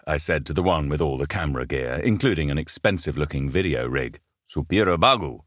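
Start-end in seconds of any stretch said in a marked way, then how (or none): 4.5-5.4 "Supira bagu" said in Japanese syllables; Japanese pronunciation. Shown as a demonstration. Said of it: "is how it's pronounced in Japanese".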